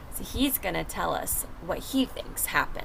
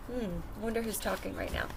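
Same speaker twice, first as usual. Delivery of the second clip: usual speaking voice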